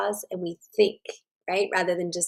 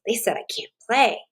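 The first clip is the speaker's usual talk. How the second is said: even, steady self-talk in a lightly voiced girl; calmer regulated thought; 3 semitones higher than usual. Girl who learned to self-regulate, thinking it over calmly. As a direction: say it to yourself, noticing and staying calm. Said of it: "And she thinks".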